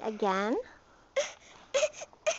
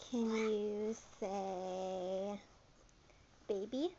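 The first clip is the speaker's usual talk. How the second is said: usual speaking voice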